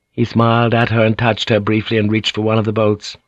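plain reading voice

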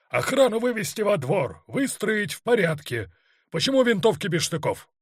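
high-pitched military orders; commanding shout. A narrator's reading. Colonel ordering the guard into the yard, commanding shout. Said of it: "командовал Черняк".

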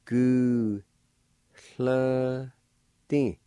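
"Gu, hla, ti" one by one; careful syllables. Shown as a demonstration. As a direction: sound out "Gu, hla, ti". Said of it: "Pronounce like this".

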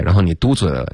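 ordinary talk

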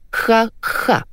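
high, deliberate "ha, ha"; separated mock laugh. A narrator's reading. Wife unamused by husband's joke, separated mock laugh. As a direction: say each ha separately, without laughing. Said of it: "раздельно произнесла жена".

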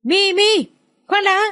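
high-pitched urgent call down the phone; shouting. Acted. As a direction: call out desperately to stop a hang-up. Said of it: "ông Phúc hét lên".

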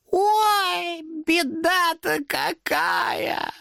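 high mock-dismayed demon cry, slow; demon exclaiming. A narrator's reading. Demon mocks Thomas over dead cat. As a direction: fake alarm, drawn out high. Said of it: "воскликнул демон".

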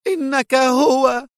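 crying out in terror; terrified cry. A narrator's reading. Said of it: "وفي ذعر هتف إيتان".

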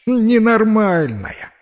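high, drawn-out jeer; theatrical high-pitched ridicule; scornfully amused. A narrator's reading. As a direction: stretch the insult out mockingly. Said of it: "И пеструшка расхохоталась".

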